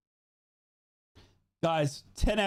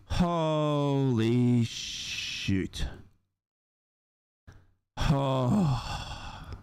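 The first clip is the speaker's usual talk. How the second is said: stunned disbelief; 4 semitones lower, much slower than usual. Real, not acted. Stunned after the win.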